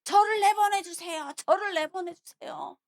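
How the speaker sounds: high, insistent acted pleading; David's clamouring plea. Young David demanding to be sent against Goliath, clamouring. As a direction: make a fuss begging to be let out. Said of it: "막 난리를 치는 거예요".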